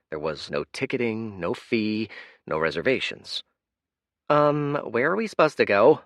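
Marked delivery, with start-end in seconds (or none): 4.3-6.0 Kaya. Acted. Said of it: "said Kaya".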